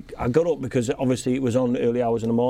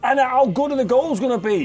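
excited; 10 semitones higher, 4 dB louder than usual. Real, not acted. Pitching fun goal rule idea.

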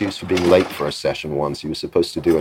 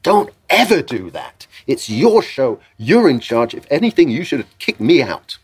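indignant dressing-down, rising higher on the key points; 6 semitones higher than usual. Retold or imitated. Visitor explodes at a young producer for taking his direction, exasperated. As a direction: act out an exasperated scolding, building as it goes. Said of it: "he explodes at this young guy".

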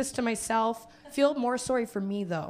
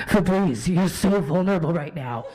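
creepy ad voice; 7 semitones lower, 4 dB louder than usual. Retold or imitated. Imagined Febreze ad voice.

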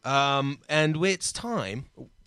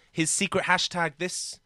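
ordinary talk

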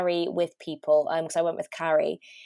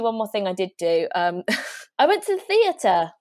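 usual speaking voice

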